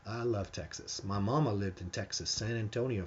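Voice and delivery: usual speaking voice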